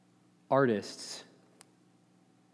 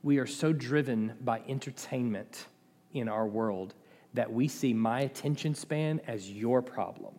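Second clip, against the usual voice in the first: ordinary talk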